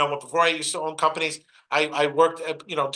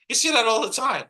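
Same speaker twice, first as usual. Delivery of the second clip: usual speaking voice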